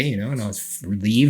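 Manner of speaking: normal talking voice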